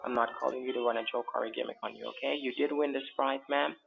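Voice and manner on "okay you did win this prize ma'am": ordinary talk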